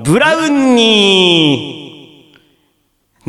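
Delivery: very slow, endings held long; pun recital. Pretend. Host presents a listener's brownie pun, slow drawn-out recital. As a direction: stretch the punchline out and let it ring.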